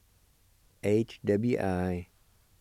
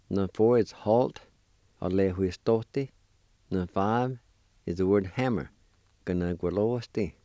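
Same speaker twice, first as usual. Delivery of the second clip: usual speaking voice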